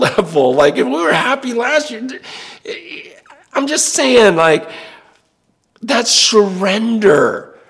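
high, loud, bubbling with joy, laughing through it; giddy high excitement with a laugh; overjoyed. Real, not acted. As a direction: burst out with delight, voice soaring. Overjoyed about happiest year yet.

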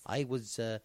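soft, low Port Talbot Welsh; low-voiced South Wales accent; Michael Sheen. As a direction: modest Welsh actor recalling a role. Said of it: "This is quite Michael Sheen".

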